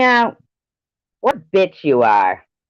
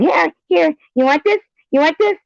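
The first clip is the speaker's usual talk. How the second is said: put-on much higher, teasing baby talk to a dog; faster than usual. Commentator imitating someone teasing a dog, high baby talk. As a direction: coax a dog with a treat, voice way up. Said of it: "talking to your dog and going".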